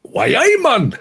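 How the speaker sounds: loud, very high Geordie cry; Geordie accent. Shown as a demonstration. Demonstrating his favourite accent for co-hosts, hammed-up Geordie. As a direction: belt it out in exaggerated Geordie, pitch leaping. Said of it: "The Geordie accent, gentlemen, is like that".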